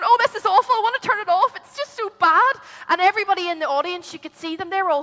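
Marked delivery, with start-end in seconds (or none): 0.0-2.5 higher, louder groaning complaint; mock disgusted family. Family in living room groans at awful singer, louder. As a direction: ham up covering your ears.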